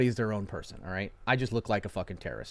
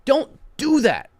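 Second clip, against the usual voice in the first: emphatic warning; 14 semitones higher, 11 dB louder, slower than usual. Real, not acted. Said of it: "warning viewers".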